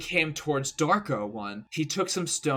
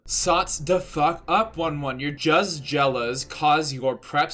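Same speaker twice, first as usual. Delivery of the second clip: slow angry rant; 2 semitones higher, 5 dB louder than usual. Acted. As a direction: lay into the haters, word by word. Autrice insulte les critiques.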